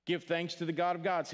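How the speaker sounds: ordinary talk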